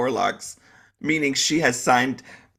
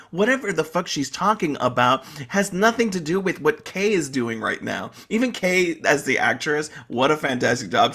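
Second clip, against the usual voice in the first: ordinary delivery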